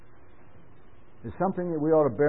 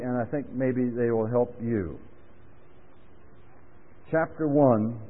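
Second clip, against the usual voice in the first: usual speaking voice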